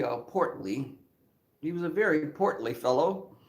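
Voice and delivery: ordinary talk, nothing marked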